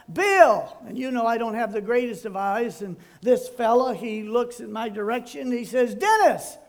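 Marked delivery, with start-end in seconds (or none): 0.1-0.8 loud, high holler across a distance. Retold or imitated. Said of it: "So I hollered out". 6.0-6.6 high, loud answering holler; calling out. Retold or imitated. Stranger calls back from blocks away.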